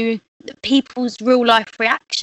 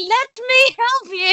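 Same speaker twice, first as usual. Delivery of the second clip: high, slow, drawn-out mock offer; hammed-up rush to the rescue; overeager helper; 11 semitones higher, slower than usual. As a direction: offer help far too keenly, pitched way up.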